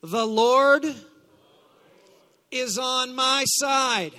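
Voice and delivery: loud, slow declaration; call to declare. Shown as a demonstration. Preacher leading congregation in repeating scripture, loud declaration. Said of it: "say this with me".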